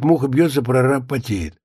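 usual speaking voice

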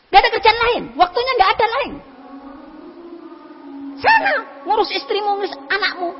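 high, indignant snapping back at the adviser; a stubborn man rebuffing his friend's advice, angry and high; angry retort. Retold or imitated. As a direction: resent the advice and lash out, pitched high. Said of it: "marah dia".

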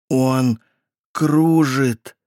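usual speaking voice

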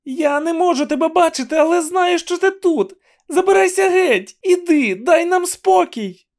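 very high-pitched frantic cry; high, insistent shout to be left in peace. A narrator's reading. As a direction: shout it high, driving the intruder away. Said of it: "крикнула вона".

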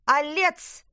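Алец shouted high and loud; shouting. A narrator's reading. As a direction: yell Алец at full volume, rising. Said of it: "крикнула Лина так громко".

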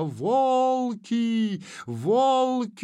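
high, drawn-out cry of alarm; frantic wail. A narrator's reading. Женька alerting the group to wolves, frantic wail. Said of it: "истошным голосом завопила Женька".

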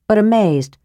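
ordinary delivery, nothing marked

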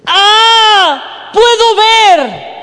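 high, drawn-out astonished scream; shouting. Retold or imitated. Old man shouts on regaining his sight, shouting. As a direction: cry it out at full volume. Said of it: "empieza a gritar".

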